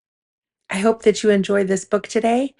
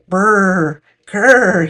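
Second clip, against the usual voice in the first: shivering brr; 4 dB louder than usual. Acted. Cold water, shivering sounds.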